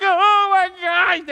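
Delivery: long, high, loud imitated scream; mock panicked wail. Daughter told she could bleed out, imitated panicked wail. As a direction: draw out a hammed-up shriek of horror.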